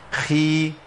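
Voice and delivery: خي with breath running through the خ; خاء مكسورة مهموسة; kha with kasra. Shown as a demonstration. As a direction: say خي with audible air on the خ. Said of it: "ركزوا معايا تاني".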